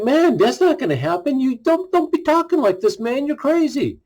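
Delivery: high, casual mock protest; Peter protesting. Peter telling Jesus not to talk like this, mock protest. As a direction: play Peter brushing it off, lightly. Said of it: "He's like".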